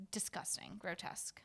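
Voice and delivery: breathy whisper of disgust. Real, not acted. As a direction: whisper it, grossed out.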